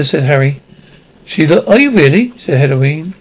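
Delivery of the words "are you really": surprised. A narrator's reading. Hermione surprised to meet Harry.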